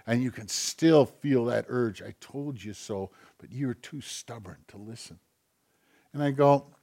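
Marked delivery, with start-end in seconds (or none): 2.0-5.2 softer, lower, muttered under the breath; muttered told-you-so. Retold or imitated. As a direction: grumble it quietly to yourself. Acted nagging quote, low quiet.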